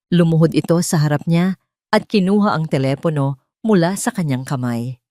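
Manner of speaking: usual speaking voice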